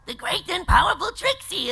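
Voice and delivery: high, loud cartoon character voice; excitable high-pitched proclamation; Snips. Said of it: "But, um, with Snips".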